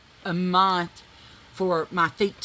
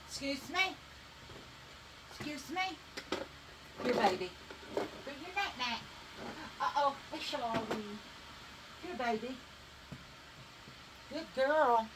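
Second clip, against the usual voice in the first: soft pet talk; 7 semitones higher, 11 dB quieter than usual. Real, not acted. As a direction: coax the dogs gently like babies. Talking to her dogs.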